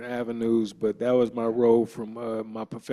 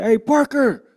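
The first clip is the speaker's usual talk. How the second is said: high, louder coach calling his name; mock coach call; 12 semitones higher, 8 dB louder than usual. Teammate voicing the coach calling Parker, pitched up. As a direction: play the coach summoning him, pitched up.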